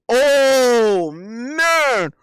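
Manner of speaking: loud, high "Oh, man" at a hard choice; excited groan. Real, not acted. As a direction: cry out as if the question hurts. Reacting to a tough food question.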